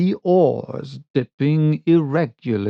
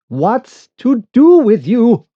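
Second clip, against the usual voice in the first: high, loud impatient demand; bursting out; 9 semitones higher, 8 dB louder than usual. Acted. Narrator challenges the shy boatman, loud outburst. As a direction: burst out at the stranger. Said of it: "I burst out".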